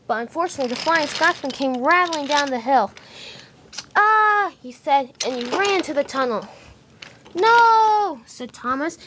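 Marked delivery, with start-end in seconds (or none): 3.9-4.5 high, loud startled ah; startled scream. Acted. Flying Scotsman startled at tunnel. 7.3-8.2 high, loud cry of no; anguished shout. Acted. Thomas sees the disaster.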